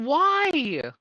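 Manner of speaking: ordinary talk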